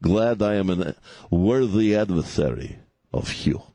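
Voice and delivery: a hint of Russian villain; Russian accent. Host mocks Putin in a mild Russian accent. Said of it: "do the rest of the show in your Russian accent".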